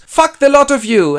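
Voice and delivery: king cursing his daughters; furious shout. Acted. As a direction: storm out yelling. Lear storms out.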